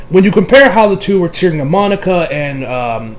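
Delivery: normal talking voice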